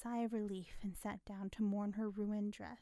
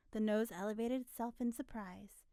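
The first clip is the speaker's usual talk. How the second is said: usual speaking voice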